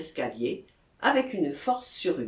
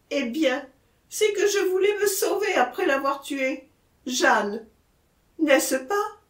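insistent, rising desperation; frantic testimony pleading for confirmation; agitated accused man; 6 semitones higher, 4 dB louder than usual. Acted. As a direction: press them to believe you, growing more urgent.

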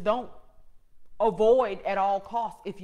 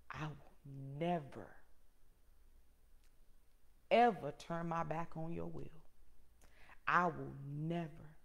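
hushed desperate prayer; 6 semitones lower, 15 dB quieter than usual. Retold or imitated. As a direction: plead quietly, barely voiced. Retold desperate prayer to God.

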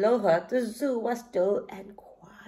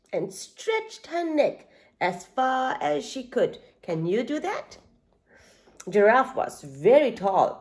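ordinary delivery

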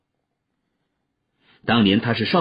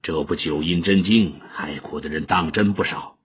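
lower, measured elder's voice; slow stern master; 6 semitones lower, 3 dB quieter than usual. A narrator's reading. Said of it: "缓缓的道".

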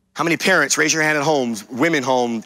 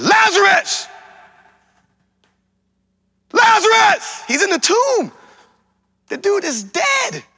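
shouting Lazarus' name. Acted. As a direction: call Lazarus out of the grave. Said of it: "Jesus shouted".

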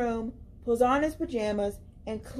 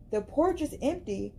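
ordinary delivery

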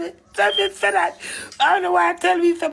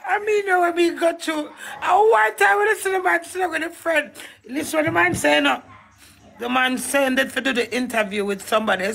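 ordinary talk

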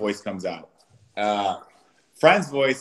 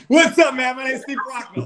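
put-on much higher, bright voice for a small child; kid-friendly voice; 5 dB louder than usual. Shown as a demonstration. Meeting a three-year-old boy, much higher kid-friendly voice. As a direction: pitch it up for a toddler. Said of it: "Introduce yourself".

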